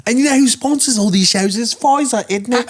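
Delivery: high mocking British voice; British accent. Mocking Russell Brand in British.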